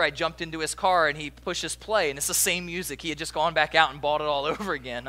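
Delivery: ordinary talk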